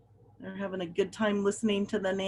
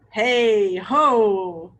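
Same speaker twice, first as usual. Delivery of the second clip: louder, a hint of a rowdy bird cheer; 7 dB louder than usual. A narrator's reading. Teacher voices the Goonie birds cheering, mildly loud. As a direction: shout it like a party crowd. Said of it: "the Goonie birds cheered".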